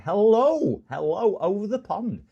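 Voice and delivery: loud, high hello over the pond; beaming transatlantic hello; excited greeting. Real, not acted. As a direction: call out a big cheery hello across the ocean. Greeting American viewer loudly.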